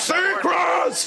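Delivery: very high, drawn-out yell; mock shout. Host demonstrates what he often shouts, mock shout. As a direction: holler the name like a catchphrase. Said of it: "I shout that several times".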